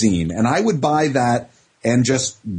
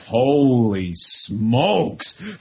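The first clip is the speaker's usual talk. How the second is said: put-on slow, drawn-out awed kid; stunned holy smokes; much slower than usual. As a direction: let the shock stretch the words out slowly. Kids stunned by Star Wars spoiler.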